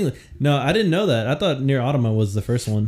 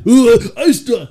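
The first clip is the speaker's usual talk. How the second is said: imitating friend; 10 semitones higher, 7 dB louder, slower than usual. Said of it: "that's what he also told me".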